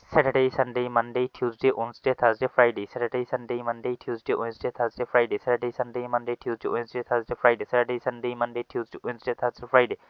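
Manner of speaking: rapid-fire days in an unbroken, even chant; no-breath recitation. Shown as a demonstration. Teacher tests how many rounds without breathing, no-breath recitation. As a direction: race through Saturday to Friday repeatedly on one breath. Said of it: "কোনো নিঃশ্বাস নেওয়া ছাড়া".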